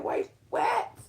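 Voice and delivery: very high-pitched, incredulous question; stunned disbelief. Retold or imitated. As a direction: squeak it out, unable to believe it. Retells disbelief at news.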